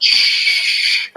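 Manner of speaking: long, loud "shh"; loud hushing. Retold or imitated. Wife silencing the husband as he comes in, loud hush. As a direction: imitate a sharp, sustained shush.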